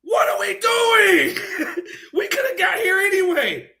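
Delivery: slow, drawn-out cry of disbelief, half laughing; stretched, pleading what are we doing through laughter; laughing exasperation. Real, not acted. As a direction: laugh it out in despair. Exasperated rant about wrestling booking.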